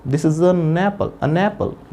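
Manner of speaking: "an apple" drawn out as one word, "uh-nnapple"; linked pronunciation. Shown as a demonstration. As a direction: carry the n of "an" into "apple". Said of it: "इकट्ठा word हो गया".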